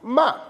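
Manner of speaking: "ma" high, loud, dropping to an abrupt stop; falling fourth tone. Shown as a demonstration. Teacher showing M with A, "ma" in falling fourth tone. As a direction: throw "ma" down from high and cut it short. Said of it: "vous faites un arrêt brusque".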